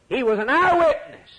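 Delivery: high and loud, proclaiming the word eyewitness. Real, not acted. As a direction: ring it out to the back pew. Preacher repeats line, full force.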